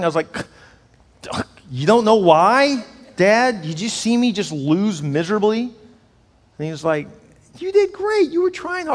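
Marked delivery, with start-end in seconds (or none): 1.7-5.6 high, loud, slow hurt protest; hurt and incredulous; upset son. Retold or imitated. Teenage son protesting to his dad after losing, hurt. 7.6-8.9 much higher, gently encouraging; comforting dad. Retold or imitated. Father comforting his son after the race, high and gentle.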